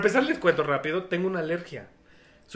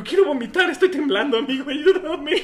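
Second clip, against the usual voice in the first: pleading; 8 semitones higher, 6 dB louder than usual. Retold or imitated. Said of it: "Le digo".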